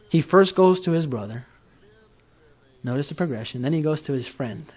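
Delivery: usual speaking voice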